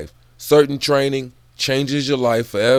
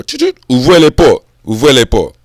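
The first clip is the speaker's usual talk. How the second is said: put-on radio chirp, then loud French-accented call; 2 semitones higher, 10 dB louder than usual. Calling open the gates on the job radio, French accent. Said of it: "I guess on the radio".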